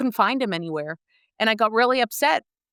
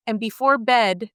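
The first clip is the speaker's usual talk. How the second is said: ordinary talk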